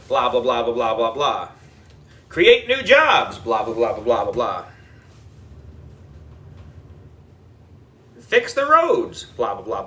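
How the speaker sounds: low, bored mumble of blahs; droning mock blah. A narrator's reading. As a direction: tune him out with a bored drone.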